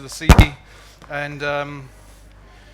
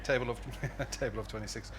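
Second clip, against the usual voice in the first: laughing; 4 semitones lower, 11 dB quieter than usual. Real, not acted. Laughs while repeating the joke.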